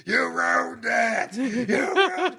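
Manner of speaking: high, slow mock whine of Vince McMahon; hammed-up high whine; yelling Vince impression. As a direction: wail it like a ruined old boss. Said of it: "Vince McMahon's just like".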